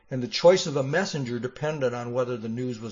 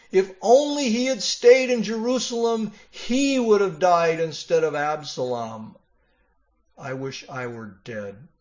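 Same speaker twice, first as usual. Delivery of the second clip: self-pitying lament; 5 semitones higher, 4 dB louder than usual. Acted. David's self-pity voiced.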